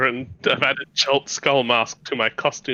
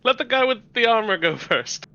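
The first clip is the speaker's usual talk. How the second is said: high urging voice; 7 semitones higher than usual. Acted. Character urges companion on.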